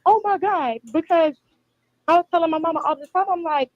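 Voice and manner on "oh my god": pitched-up, half-hearted scream; retold screaming. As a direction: imitate screaming without the volume. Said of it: "And so I was screaming".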